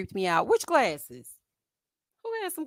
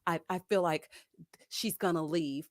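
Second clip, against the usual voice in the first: low, hesitant imitation of him; mock his halting complaint; mimicked man; 5 semitones lower, 4 dB quieter than usual. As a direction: lower voice, falteringly put on. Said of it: "And then he's like".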